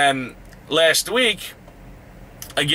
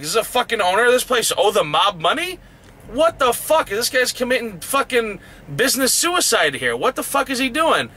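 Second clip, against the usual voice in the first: high-pitched, exasperated disbelief; retold indignant questions, voice leaping up; incredulous outrage; 7 semitones higher than usual. Driver retelling his question about the owner's debts, incredulous outrage.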